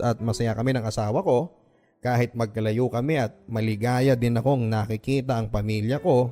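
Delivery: plain reading voice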